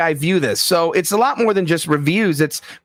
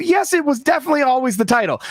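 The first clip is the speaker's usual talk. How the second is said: insistent retort; 8 semitones higher, faster than usual. Retold or imitated. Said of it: "I said".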